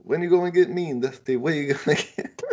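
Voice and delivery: high, lisping Southern wrestler voice; Dusty Rhodes voice. Retold or imitated. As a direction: lisp it like the old promo guy. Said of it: "I like doing his accent".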